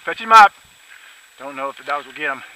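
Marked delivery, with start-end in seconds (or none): 0.1-0.5 loud, high call across the field; shouting to dog. Real, not acted. Hunter calling to his dog far off, shouting. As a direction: yell the command out to the dog.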